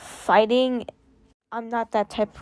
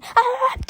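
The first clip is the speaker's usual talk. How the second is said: sudden shrill shriek, far higher; pretend scream; 14 semitones higher, 3 dB louder than usual. Imagining her own reaction, a high sharp scream. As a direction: let out a startled yelp. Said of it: "imagine me screaming".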